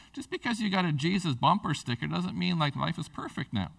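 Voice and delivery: ordinary talk, nothing marked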